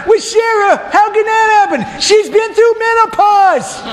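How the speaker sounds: high, slow comic doubt; doubting Abraham. Acted. Abraham questions God about Sarah in a high comic voice. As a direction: squeak out the doubt, wide-eyed.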